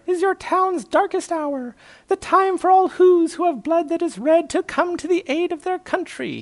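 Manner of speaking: very high squeaky declaration; crying tiny mayor. A narrator's reading. Mayor rallies the lad on the tower, high impassioned cry. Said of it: "cried the mayor".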